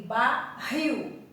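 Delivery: "barril" slowly split, weight on -RIL; stress on final syllable. Shown as a demonstration. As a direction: break "barril" in two and land on the last syllable. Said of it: "rio é a sílaba tônica". ("barril" devagar, destacando a última sílaba)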